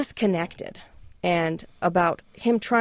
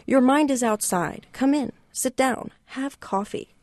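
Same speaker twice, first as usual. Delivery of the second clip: a hint of the shop owner, higher pitched; Angel's voice; 8 semitones higher than usual. Angel urging distracted Richard to sit for coffee, lightly higher voice. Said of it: "Angel said".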